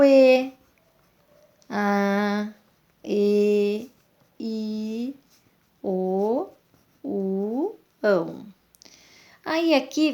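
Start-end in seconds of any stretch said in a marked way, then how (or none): 1.7-8.5 "A", "E", "I", "O", "U", "ão" each sounded on its own; vowel sounds. Shown as a demonstration. Teacher running through the vowels for pupils, each crisp and separate. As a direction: sound out the vowels "A, E, I, O, U" and then "ão" one at a time. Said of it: "Olha aqui".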